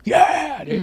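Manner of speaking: high, pumped-up bro cheer; hyped cheer. Retold or imitated. Playing video games with nephews, hyped and high. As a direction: pump it up like a teen gamer. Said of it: "I just like".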